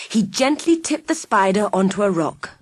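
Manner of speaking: ordinary delivery, nothing marked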